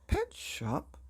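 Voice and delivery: high, voiced "Pet shop?" in disbelief; father repeating it in disbelief; incredulous repeat. A narrator's reading.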